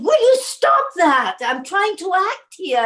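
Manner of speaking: high, loud and slow, fed up; mock outburst at the man flicking paper; retold snap. Teen actress turns on director flicking paper, exasperated.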